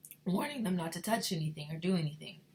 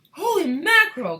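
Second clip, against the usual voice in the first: high, very loud exclamation; alarmed cry; 11 semitones higher, 15 dB louder than usual. A narrator's reading. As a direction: cry out in shock. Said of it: "cried Mister Teavee".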